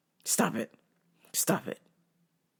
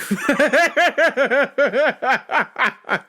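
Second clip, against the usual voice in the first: loud laugh that trails down; real laughter; 4 semitones higher, 3 dB louder than usual. Real, not acted. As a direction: crack up at your own Jamaican joke. Laughing at his own joke.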